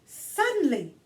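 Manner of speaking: put-on bright read-aloud for children; gentle children's book narration; storybook reading. A narrator's reading. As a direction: read it like story time for kids. Reads picture book aloud.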